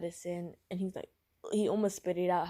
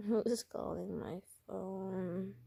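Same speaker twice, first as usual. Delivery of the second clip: slow, softer feigned sick voice; Robin; 4 dB quieter, much slower than usual. Acted. Sick character answering the phone, slow feeble voice. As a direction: drag it out weakly, as if ill. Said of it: "this is Robin talking right now".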